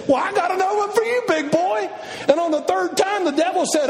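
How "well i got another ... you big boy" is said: playful retort. Pretend. Jesus voiced answering devil.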